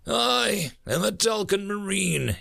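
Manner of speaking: ordinary delivery, nothing marked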